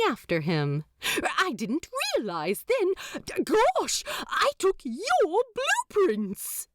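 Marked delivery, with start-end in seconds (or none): 1.4-6.7 high, dismayed angel voice; flustered angel. A narrator's reading. Aziraphale confessing to the demon, high and dismayed. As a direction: fret over the stolen blueprints.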